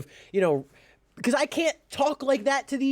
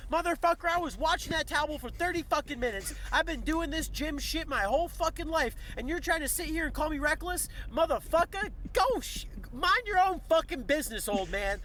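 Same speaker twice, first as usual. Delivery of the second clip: raised, heated cursing rant; angry rant; 6 semitones higher, 5 dB quieter than usual. Acted. Venting at the old man who moved his towel, heated rant. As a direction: let the anger out at him, pitch up.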